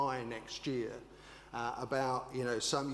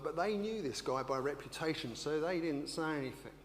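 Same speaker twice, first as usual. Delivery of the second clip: ordinary talk, nothing marked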